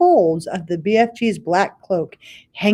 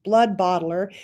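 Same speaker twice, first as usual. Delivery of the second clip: plain reading voice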